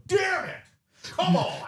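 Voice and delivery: very high strained mock yell; mock screaming. Co-host mimicking the man's outburst at a dog, high screaming. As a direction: squeal it out like someone losing it. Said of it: "walk up to me and go".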